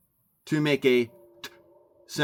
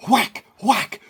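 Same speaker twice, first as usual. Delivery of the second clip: mock whacking; 8 semitones higher, 6 dB louder than usual. Miming beating a dead horse.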